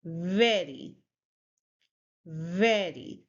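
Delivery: VERY with a clear buzzed v, never w; v-sound demo. Shown as a demonstration. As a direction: say VERY slowly, falling, v held. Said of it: "it's v sound, so you'll say".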